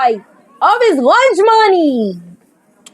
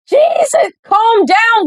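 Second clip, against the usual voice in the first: mocking shout; 7 semitones higher, slower than usual. Mean girl snaps back.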